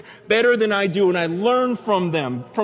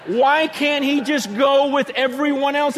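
high, mock-exasperated whine; exasperated complaint; 8 semitones higher, 4 dB louder than usual. King complaining about the lone dissenting prophet, high exasperation.

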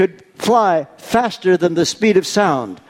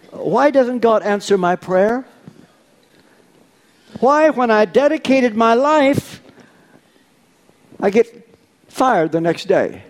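raised, pleading questioner; pleading complaint; 6 semitones higher than usual. Retold or imitated. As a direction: voice a hurt believer asking why. Voicing a believer's complaint.

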